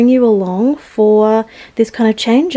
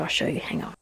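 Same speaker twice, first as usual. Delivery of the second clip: whispering; 12 dB quieter, faster than usual. Real, not acted. Unvoiced aside.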